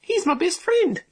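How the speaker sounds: very high squeaky put-on voice; mock foreigner impression. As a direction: imitate him in a silly, sky-high voice. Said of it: "Here's a video of him talking".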